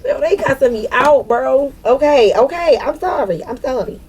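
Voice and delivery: high, giggly pleading; amused apology. Real, not acted. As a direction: apologise while tickled by being told off. Laughing, playfully apologising after scolding.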